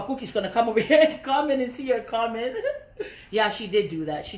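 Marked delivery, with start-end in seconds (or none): 1.1-2.6 laughing. Real, not acted. Laughing while scolding commenter. 2.6-3.3 laughing. Real, not acted. Laughing after teasing.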